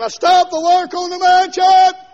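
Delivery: high, loud mock command; God as foreman. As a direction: bark the order to stop building. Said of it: "I better, better rub his name out".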